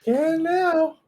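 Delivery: very high, squeaky put-on voice; squeaked mimicry. Saying it for a fan, mock squeak. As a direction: squeak it out far above your voice.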